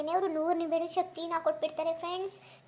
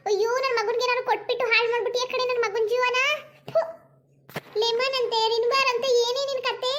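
put-on higher, louder nagging old mother-in-law; scolding mother-in-law; 5 semitones higher, 6 dB louder than usual. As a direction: berate the silly daughter-in-law. (ಬೈಯುವ ಅತ್ತೆಯ ಧ್ವನಿ)

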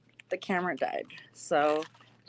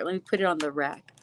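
ordinary talk

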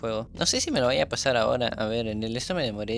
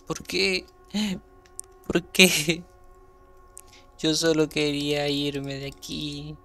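higher, drawn-out mock wail; mock lament; 3 semitones higher, slower than usual. Player killed just wanting to leave, mock lament. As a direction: plead slowly, hamming up the despair.

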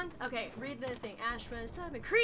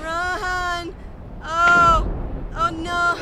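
panicked; 5 semitones higher, 8 dB louder, much slower than usual. Real, not acted. Ring nearly kills her.

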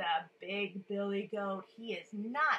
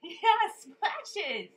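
plain reading voice

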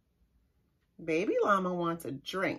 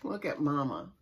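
usual speaking voice

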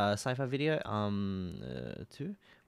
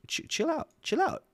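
overwhelmed. Real, not acted. Overwhelmed reacting to the video.

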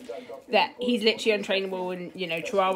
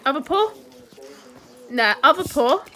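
squeaky praising a dog; 7 semitones higher, 6 dB louder than usual. Real, not acted. Training the dog, giving a trick.

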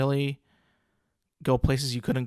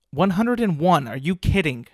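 loud, shocked readout of the age; startled, raised voice at the search result; real surprise; 3 semitones higher, 6 dB louder than usual. Real, not acted. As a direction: blurt the number out in disbelief. Reacting to Queen's age.

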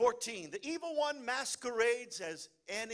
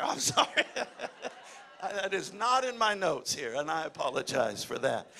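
giggling through an apology; real laughter breaking through; laughing apology; 3 semitones lower, 4 dB louder than usual. Real, not acted. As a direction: laugh at your own slip. Preacher cracks up at his joke.

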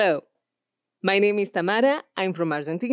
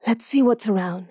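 curious whisper; 3 semitones higher than usual. Shown as a demonstration. Heroine whispering while searching a locked room. Exploring quietly.

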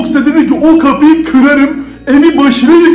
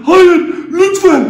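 higher, slow, half-laughing fake sob; mock crying plea; 4 semitones higher, slower than usual. Youtuber fake-cries at Sonic's threat to break in. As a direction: beg like you're terrified, but hammed-up.

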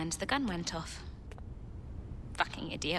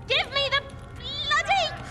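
furious demand; 10 semitones higher than usual. Acted. Owner wrestling housekeeper for the gun, furious. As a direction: demand it at the end of your patience.

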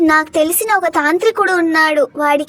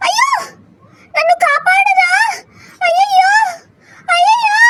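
high, loud frantic wailing for rescue; desperate cry for help; 13 semitones higher, 3 dB louder than usual. Acted. Grandmother begs her son to save her, frantic wailing. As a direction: wail for your son to save you.

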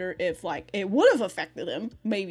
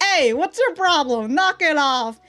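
loud, higher mock scolding; scolding yell; 6 semitones higher, 7 dB louder than usual. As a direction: tell him off sharply, louder and up. Voicing Yoshino scolding.

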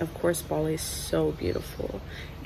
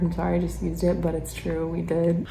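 usual speaking voice

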